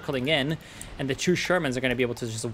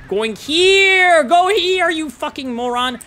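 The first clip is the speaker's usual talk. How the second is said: real angry yell, much higher and louder; furious shout; 13 semitones higher, 9 dB louder, slower than usual. Real, not acted. Caster screams at a unit pathing the wrong way, furious shout.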